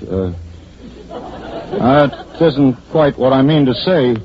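hesitant speech full of uhs and restarts; faltering speech demo. Acted. Actor showing Miss Tuttle how easy speechmaking is, faltering badly. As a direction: stumble along, losing the thread of the speech. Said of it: "start talking like this, see?".

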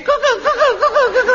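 very high mock cuckoo; mocking cuckoo call. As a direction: mimic the bird shrieking. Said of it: "out he comes again".

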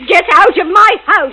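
high angry shout; shouting angrily. Acted. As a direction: yell it at him. Repeats order, louder.

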